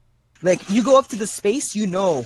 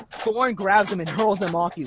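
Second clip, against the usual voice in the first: ordinary delivery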